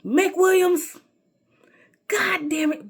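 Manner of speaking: high, loud exasperated shout of a name, then a whispered curse; angry teacher. Retold or imitated. Teacher cussing out a mouthy student, loud and high, then whispered. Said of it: "my teacher was like".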